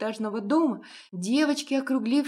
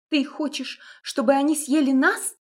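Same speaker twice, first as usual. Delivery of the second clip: high, louder nervous snap; nervous outburst; 6 semitones higher, 6 dB louder than usual. A narrator's reading. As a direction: blurt it out in fright. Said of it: "нервно выпалила Маша".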